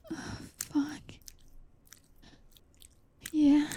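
normal talking voice

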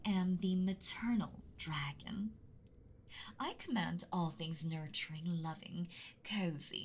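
normal talking voice

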